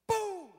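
sudden high-pitched "boom"; abrupt explosive crash sound; imitated crash. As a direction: burst out with the impact noise.

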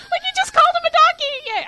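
high, loud, fast delighted squeal; gleeful squeal. Real, not acted. Fan reliving her favourite TV moment, gleeful squeal.

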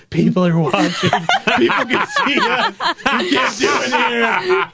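high, slow, laughing panicked yelp; laughing mock panic. On the vacation balcony, laughing high panicked plea to partner. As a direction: squeal in giggly alarm. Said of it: "And I was like".

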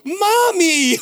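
very high, loud, drawn-out imitated child cry; child's sudden revelation. Dad imitates son suddenly realising mom is home, thrilled squeal. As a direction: squeal it like a kid who just realised. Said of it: "it's like he had this revelation".

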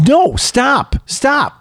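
put-on high pleading kid voice; mock kids crying no, stop; kids begging. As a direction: plead like a kid who wants tennis. Kids protesting in retold scene.

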